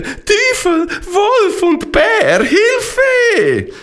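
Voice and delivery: very high, panicky cry for help; frightened cry. A narrator's reading. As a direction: wail in terror, words drawn out high. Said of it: "ruft Arelina verschrocken".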